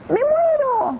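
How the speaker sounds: put-on high squeaky scream of a timid old woman; terrified scream. Old woman falling from the wardrobe, high terrified scream. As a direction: shriek it as you fall.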